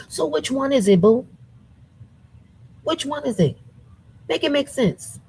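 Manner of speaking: high, pointed demand; incredulous questioning. Real, not acted. Calling out her critics, high and incredulous. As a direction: pin them down, disbelieving.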